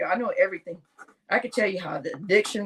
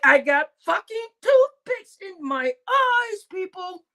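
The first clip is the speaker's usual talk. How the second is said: high, slow, drawn-out groaning plea; 8 semitones higher, slower than usual. Retold or imitated. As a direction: stretch it out like barely awake.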